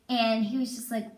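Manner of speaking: normal talking voice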